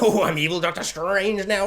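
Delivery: hammed-up smug evil voice; lightly voiced evil sorcerer; mock villain. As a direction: play a cheesy villain. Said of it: "he's like".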